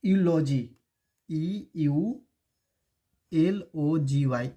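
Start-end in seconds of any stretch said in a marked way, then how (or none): 1.3-4.5 letters E U L O जी Y said one by one, slowly; spelling out. Shown as a demonstration. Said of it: "अगर इसको ऐसे लिखे".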